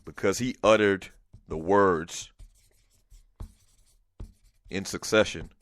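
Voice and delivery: each word spaced by long pauses; emphatic slow proclamation. Shown as a demonstration. Host solemnly reading out a caller's award nomination. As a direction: announce it slowly, word by word, like a ceremony. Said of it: "make it emphatic".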